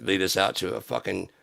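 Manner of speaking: usual speaking voice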